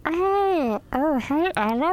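very high, squeaky little bug greeting. Retold or imitated. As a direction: squeak it like a tiny insect. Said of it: "And do your bug voice".

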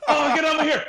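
high, loud hammed-up movie dub shout, laughing; dubbed kung fu movie. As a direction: squeal it like a badly dubbed martial arts film. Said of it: "Crouching Tiger, Hidden, Hidden Dragon".

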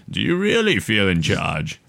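slow, measured villain voice; calm, evil Bane. Acted. Podcast host quoting a villain to the man in his office, calm evil tone. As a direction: put on a calmly menacing villain's voice. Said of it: "I can't even really do the voice".

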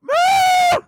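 a sudden high squeal of laughter. Real, not acted. Comic cracking himself up at his own bit, squealing laugh. Burst after the joke.

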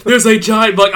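loud, high, urgent; excited. Retold or imitated. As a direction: shout out the giant buck. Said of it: "It's like".

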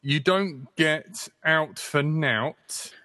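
slow, drawn-out mock northern saying; halting northern accent try; attempted northern accent. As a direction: read it out slowly in a put-on northern voice. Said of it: "Do the accent".

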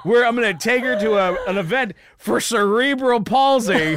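loud, high, worked-up mock scheming; sarcastic ranting. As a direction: belt out the absurd plan in disbelief. Sarcastic rant about supposed plan.

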